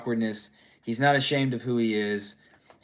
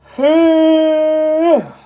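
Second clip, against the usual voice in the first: long, high, loud weary exhale; tired sigh; 14 dB louder than usual. Real, not acted. Tired dad apologizing to viewers, loud weary sigh. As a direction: let out a big drawn-out tired sigh. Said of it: "a tired dad".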